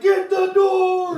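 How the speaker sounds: high, loud, slow crowd chant; slow, high shouted chant; crowd shouting back. Retold or imitated. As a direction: roar it back like an arena crowd. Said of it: "they're like".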